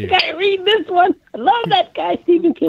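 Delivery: put-on high, excited old reader; old fan voice. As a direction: gush like an elderly fan. Imitating an elderly fan.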